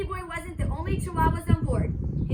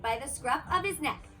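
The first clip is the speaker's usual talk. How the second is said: plain reading voice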